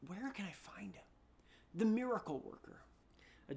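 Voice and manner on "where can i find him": whispered, urgent search question; desperate whisper. Acted. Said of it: "desperation in his voice".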